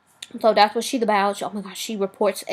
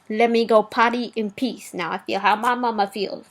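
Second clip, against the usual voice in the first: ordinary talk, nothing marked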